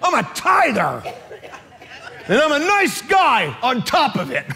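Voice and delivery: high, hammed-up angry whine; mad. Husband arguing with God over giving thanks, mock-mad whine. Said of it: "I'm mad".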